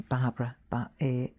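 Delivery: imitating a Liverpool customer; Scouse accent. Demonstrator trying out her customer's accent, Scouse. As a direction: put on a low-key Scouse lilt. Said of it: "Scouse, Liver-, Liverpool".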